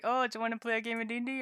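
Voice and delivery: breezy invite voice. Retold or imitated. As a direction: rattle it off like a chirpy mock invitation. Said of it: "It was kinda like".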